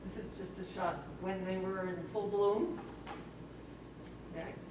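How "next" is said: ordinary delivery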